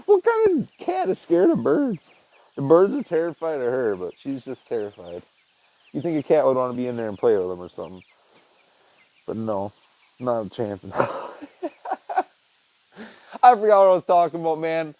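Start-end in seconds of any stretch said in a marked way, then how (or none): 0.1-2.0 much higher, slow mock-scolding voice; slow high teasing to the cat; teasing pet voice. Real, not acted. 11.6-15.0 fast, laughing through it; quick laughing aside. Real, not acted. Laughing at losing his thread.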